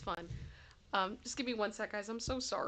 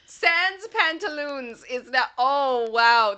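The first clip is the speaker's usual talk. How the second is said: relishing; 3 semitones higher, 7 dB louder, slower than usual. Real, not acted. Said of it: "I wanna keep that in my brain".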